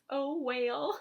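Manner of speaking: high, loud, on the verge of laughing; amused exclamation. Real, not acted. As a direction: belt it out, then crack up. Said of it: "can't say it without laughing".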